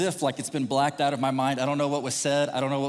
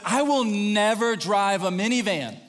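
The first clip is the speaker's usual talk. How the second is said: higher, slow mock declaration; emphatic vow; 5 semitones higher, 3 dB louder, slower than usual. Preacher mimicking someone refusing minivans, emphatic. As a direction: stretch out the grand refusal.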